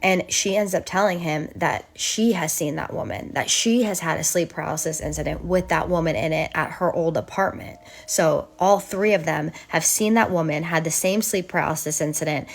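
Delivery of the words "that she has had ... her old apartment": plain reading voice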